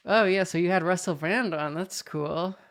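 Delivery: dismissive. Retold or imitated. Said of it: "He was just like".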